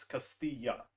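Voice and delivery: "Castilla" slow with the double L as a Y, kas-tee-ya; correct pronunciation. Shown as a demonstration. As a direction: say "Castilla" with a Y sound for the L's. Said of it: "The two L's are pronounced as a Y".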